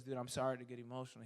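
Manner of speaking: quick, low, soft apology; retold tearful apology. Fitness winner apologizes for crying in the terminal, quietly emotional. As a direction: imitate a man choked up, understated. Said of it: "he started to cry".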